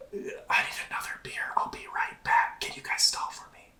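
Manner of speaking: hushed whisper, a bit fast. Real, not acted. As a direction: whisper it as you sneak away. Sneaking off for beer.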